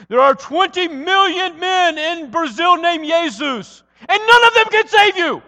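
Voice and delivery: huge, high booming shout, drawn out; booming professor's voice. Retold or imitated. Missions professor from Brazil warning his students, booming. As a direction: thunder it out to wake the back row. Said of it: "say with his booming voice".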